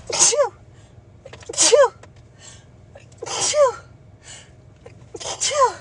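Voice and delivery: loud high sneezes; sneezing. Real, not acted. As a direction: sneeze hard, again and again. Sneezing fit, apologises after.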